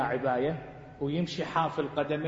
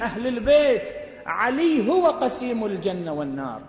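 loud, rising declamation; preaching at full force; 7 semitones higher, 5 dB louder than usual. Acted. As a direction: drive it home to the congregation. Sermon climax denouncing parties.

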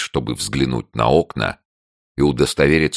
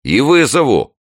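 high, loud retort; threatening neighbour; 13 semitones higher, 7 dB louder than usual. A narrator's reading. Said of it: "пообещал голос". (угрожающе, громко и высоко)

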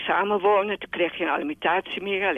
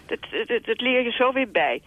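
quoting a cheerful employer; 6 semitones higher than usual. Retold or imitated. Retold reply of the new employer.

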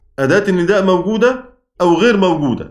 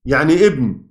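usual speaking voice

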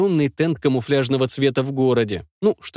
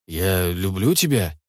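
lower, slow, tender confession; 6 semitones lower, slower than usual. Acted. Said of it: "просто повторил свои слова".

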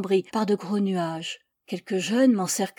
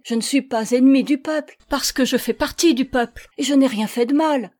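loud, raised earnest objection; indignant, sincere protest; 5 semitones higher, 7 dB louder than usual. A narrator's reading. Accused woman objects to the mob, indignant and sincere. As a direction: protest your innocence with indignation. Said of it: "J'objectai avec indignation et en toute sincérité".

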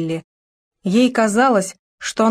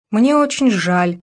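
ordinary delivery

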